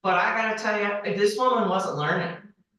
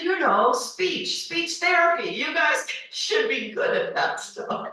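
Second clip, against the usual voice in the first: higher, lightly voiced stranger explaining speech therapy; a hint of a know-it-all stranger, pitched up; imitating the man; 9 semitones higher than usual. Man on the train, retold quote.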